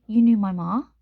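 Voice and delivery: loud, shaken question; shocked. Acted. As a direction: blurt it out in shock.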